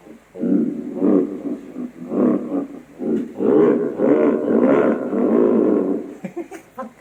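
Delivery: long, loud horror laugh through a voice changer; low monster laugh, drawn out. Pretend. Playing with the horror setting of a voice changer, horror laugh. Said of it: "Pang horror yung boss na yung voice".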